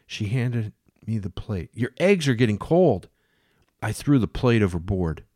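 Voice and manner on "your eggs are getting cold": higher, louder voice for Jane; a mad woman serving the captain breakfast. Acted. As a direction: play her as doting and unhinged.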